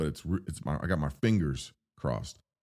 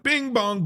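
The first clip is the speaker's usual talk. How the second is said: squeaky high bing bong, much higher and louder; bing bong noise; 5 dB louder than usual. Retold or imitated. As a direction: bounce the words like a toy. Imitating the seats bouncing.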